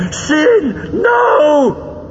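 very high, loud villain scream; terrified scream. A narrator's reading. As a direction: scream in panic as death swings round. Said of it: "he screamed".

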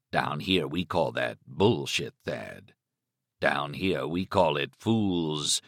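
slow, low Southern drawl in his head; low drawling Stark voice. A narrator's reading. Stark mocking Thad inside his head, slow and low. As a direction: let Stark sneer it slowly from down low.

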